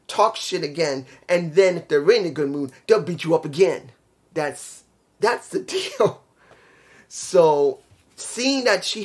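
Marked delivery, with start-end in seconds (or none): none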